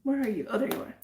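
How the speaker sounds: ordinary delivery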